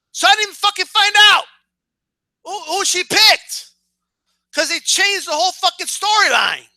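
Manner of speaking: high, worked-up complaint; indignant, voice pushed up throughout; exasperated rant. Real, not acted. Viewer denied the answer by a storyline change, high exasperation.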